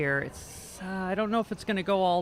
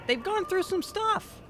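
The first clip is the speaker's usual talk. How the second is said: mock solemn high; 12 semitones higher than usual. Mock solemn Scotland remark.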